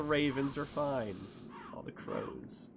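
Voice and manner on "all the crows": breathy hushed whisper aside; whispering under the breath. Real, not acted. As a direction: drop to a secret whisper. Hushed aside about the crows.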